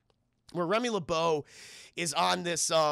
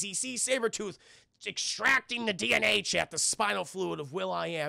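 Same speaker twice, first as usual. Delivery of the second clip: usual speaking voice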